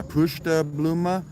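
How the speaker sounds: mock Italian accent, slow and sing-song; Italian accent, every syllable. Shown as a demonstration. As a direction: roll the name out Italian-style, high. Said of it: "I did that with an Italian accent".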